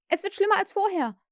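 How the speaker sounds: high, loud group outcry; shouting lost boys. A narrator's reading. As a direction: cry it out in a panic. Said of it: "riefen sie".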